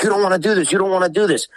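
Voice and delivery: high-pitched, insistent retold plea; imitated urgent warning. Brother holding fighter back, retold.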